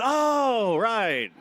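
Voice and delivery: high startled oh, then a sheepish right; a sudden dawning embarrassment; mock oh right of a caught-out dad; sheepish realization. Realizing the cord mistake.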